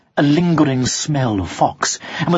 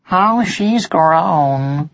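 slow musing; 2 semitones higher, much slower than usual. A narrator's reading. Martin's thought, drawn out.

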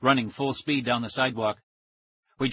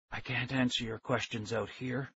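very soft, whispery man's voice; thin whisper; 9 dB quieter than usual. A narrator's reading. Stranger reassuring captured kids, thin whisper. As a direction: speak in a thin, hushed voice. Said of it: "He had a thin whisper of a voice".

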